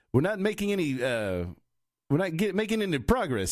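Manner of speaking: high, pompous cartoon officer voice; Zapp Brannigan. Shown as a demonstration. Operator in an airplane reporting back, pompous voice. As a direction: puff it up with pompous self-importance, pitched high. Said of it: "like a real pompous".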